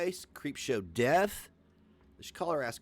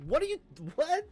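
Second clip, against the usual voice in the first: high incredulous squeak, much higher; baffled; 14 semitones higher than usual. Retold or imitated. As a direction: react in stunned disbelief. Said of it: "I'm like".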